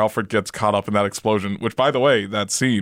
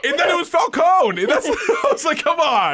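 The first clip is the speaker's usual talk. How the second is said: exasperated shout. Real, not acted. Vents to co-host about the twist, high exasperated shout. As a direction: throw your hands up, much higher.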